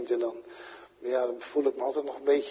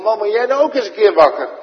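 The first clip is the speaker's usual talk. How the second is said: loud, high, urgent captain's shout; the ship's captain yelling at sleeping Jona; captain shaking him awake; 8 semitones higher, 11 dB louder than usual. Retold or imitated. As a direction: rouse the sleeping Jona, demanding. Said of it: "die moet hem echt wakker schudden".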